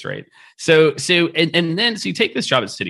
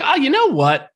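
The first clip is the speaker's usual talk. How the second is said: much higher, sing-song teasing voice; high playful mock-casual quote; mock nonchalance. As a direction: toss it off lightly, mocking the choice as easy. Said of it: "and then you're like".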